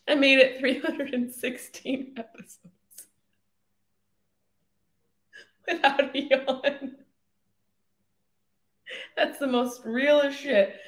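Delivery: high voice squeezed by laughter; helplessly amused, words riding on laughter; laughing disbelief. Real, not acted. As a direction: barely get it out through real giggles. Laughing over first yawn on air.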